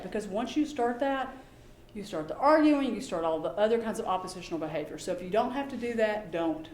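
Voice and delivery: normal talking voice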